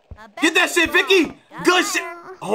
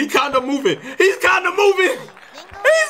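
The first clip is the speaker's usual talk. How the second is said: laughing excitement building; 10 semitones higher than usual. Real, not acted. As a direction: rise higher with each repeat. Rising laugh at player.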